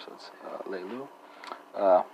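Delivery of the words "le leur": "le lu" softly, ending on the vowel with no R; R not noticeable. Shown as a demonstration. Teacher repeating French pronoun for learners, soft "le lu" without R. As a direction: say "le lu" softer, dropping the R. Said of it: "make noticeable there".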